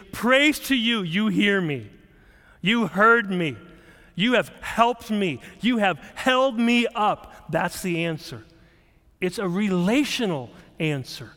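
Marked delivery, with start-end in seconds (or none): 0.1-7.5 joyful praise. Retold or imitated. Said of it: "All he says is".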